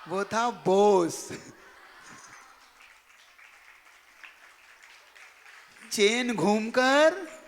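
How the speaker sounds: slow, loud reveal, every word weighted; dramatic punchline. Real, not acted. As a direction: drag out the twist for the crowd. Punchline of the chain story. (धीमा, ज़ोरदार नाटकीय खुलासा)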